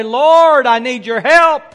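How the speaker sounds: loud, high cry for help; a praying woman loudly calls out to the Lord for help; calling out to the Lord. Acted. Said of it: "I call out to the Lord".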